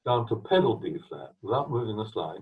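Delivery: ordinary talk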